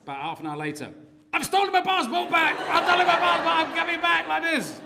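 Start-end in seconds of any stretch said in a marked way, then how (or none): 1.3-4.8 high, loud joyful cry; triumphant shout. Retold or imitated. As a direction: shout it out in victory, laughing. Triumphant call retold.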